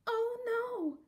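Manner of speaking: high alarmed cry; frightened cry. A narrator's reading. Bees hearing the bear crash, high frightened cry. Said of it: "they cried".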